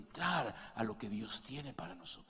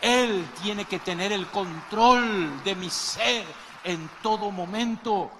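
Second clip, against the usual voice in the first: slow, loud preaching, pitched higher; forceful preaching; 7 semitones higher, 13 dB louder, slower than usual. Acted. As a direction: drive each word home to the congregation. Predicación con fuerza. (predicación lenta y muy fuerte)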